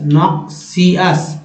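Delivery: Noxious slowly split, nok-si-us; syllable-by-syllable. Shown as a demonstration. Teacher repeating a word for learners, syllable by syllable. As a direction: break noxious into separate syllables.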